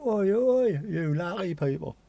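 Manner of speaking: hammed-up comedian greeting; comic catchphrase. Greeting viewers with the comedian's catchphrase.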